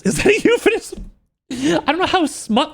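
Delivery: squeaky high incredulous question; shocked question. Real, not acted. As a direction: ask it in disbelief. Mock shock at the book.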